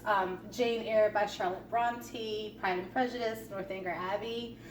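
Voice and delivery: usual speaking voice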